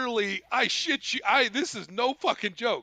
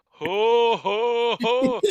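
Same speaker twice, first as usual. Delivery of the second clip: big, raised "ho ho ho" imitation; bellowing laugh; 4 semitones higher, 6 dB louder, much slower than usual. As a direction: boom out a mock belly laugh. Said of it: "has this big bellow of a laugh".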